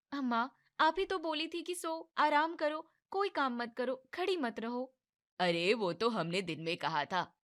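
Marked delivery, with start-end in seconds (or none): 0.1-5.0 higher whiny daughter-in-law voice; young daughter-in-law. Acted. Young wife protests to her mother-in-law, higher and whiny.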